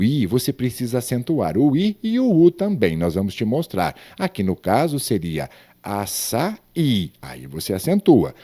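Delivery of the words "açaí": "açaí" broken up as a-ça, Í; separated hiatus. Shown as a demonstration. Teacher shows pupils a hiatus in i, separated hiatus. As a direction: set the final stressed í of "açaí" apart. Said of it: "eu separei só o hiato".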